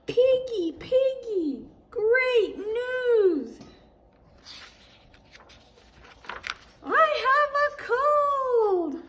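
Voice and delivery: gleeful shout. Acted. Gerald tells Piggy gleefully.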